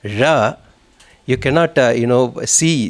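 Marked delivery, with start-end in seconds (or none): 0.0-0.6 RA said as the curled Tamil zh sound; special letter sound. Shown as a demonstration. Teacher showing students the hard Tamil letter, curled RA. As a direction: curl the tongue back to the palate on RA. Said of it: "find it very difficult to pronounce".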